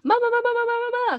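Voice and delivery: high, loud repeated call for mama. Retold or imitated. Injured daughter calls mom from the couch, high loud call.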